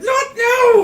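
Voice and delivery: high, loud panicked yell; shouting. Retold or imitated. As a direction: yell it mid-battle. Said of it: "you'd be like".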